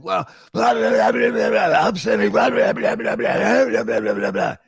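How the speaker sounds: loud, high mock boss rant; boss yelling. Boss yelling so the building hears, loud mock rant. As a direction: rant at him with a hammed-up boss yell. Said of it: "He said".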